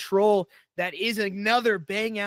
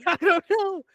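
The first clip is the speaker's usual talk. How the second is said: laughing loudly in disbelief; 12 semitones higher, 5 dB louder than usual. Real, not acted. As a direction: laugh it out, stunned. Laughing at crazy play.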